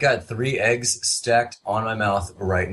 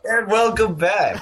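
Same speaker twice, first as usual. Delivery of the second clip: host intro; 10 semitones higher than usual. Acted. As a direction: welcome listeners back like a radio host. Show host intro after break.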